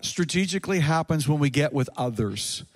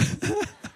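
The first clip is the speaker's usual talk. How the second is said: soft laugh; 7 dB quieter than usual. Real, not acted. Laughs after his own bit.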